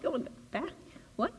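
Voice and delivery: soft rising disbelief; incredulous echo. Retold or imitated. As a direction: repeat it as if you can't believe it. Driver echoes the order incredulously.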